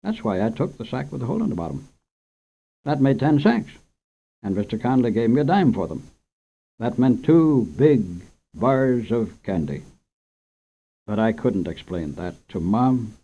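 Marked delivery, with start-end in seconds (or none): none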